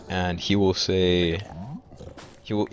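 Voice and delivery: normal talking voice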